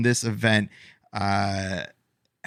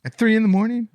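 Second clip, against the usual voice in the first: incredulous; 11 semitones higher, 4 dB louder, much faster than usual. Real, not acted. Incredulous question.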